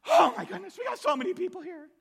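high, loud, fast fluster; frazzled hostess rushing about; flustered Martha. Retold or imitated.

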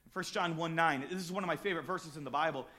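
normal talking voice

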